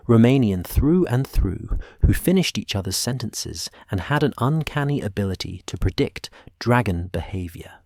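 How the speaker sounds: usual speaking voice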